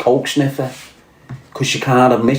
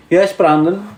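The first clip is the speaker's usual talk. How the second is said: ordinary talk, nothing marked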